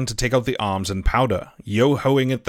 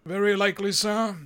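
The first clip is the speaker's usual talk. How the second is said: higher, dry reply; dry captain; 8 semitones higher than usual. A narrator's reading. Captain answers the doctor drily. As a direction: answer with cool doubt. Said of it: "replied Captain Smollett".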